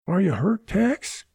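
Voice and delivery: put-on small boy's high, anxious question; gulping, frightened kid's voice; gulped worry. A narrator's reading. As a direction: pitch it way up, a worried child. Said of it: "Jimmy gulped".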